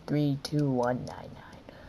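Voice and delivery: soft unvoiced read-out; whispered numbers. Real, not acted. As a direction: whisper the numbers under breath. Reading game code.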